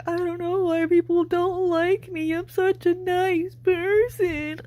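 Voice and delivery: high-pitched mock self-pity, slowing at the end; mocking imitation. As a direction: mimic her poor-me complaint way up high. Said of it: "you walk around saying".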